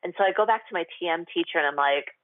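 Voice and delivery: ordinary talk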